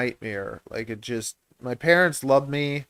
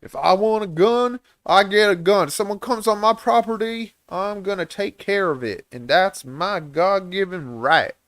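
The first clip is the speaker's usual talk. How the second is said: higher, put-on American accent, defiant; American gun owner; 5 semitones higher than usual. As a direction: declare it like a hammed-up American gun-rights man. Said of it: "as lots of Americans".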